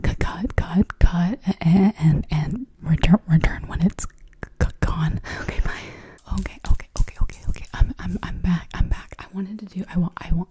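ordinary delivery

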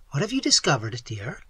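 ordinary delivery

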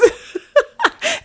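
long high-pitched laugh; high laughing fit. Real, not acted. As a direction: crack up high. Laughing at cute face.

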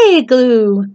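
ordinary delivery

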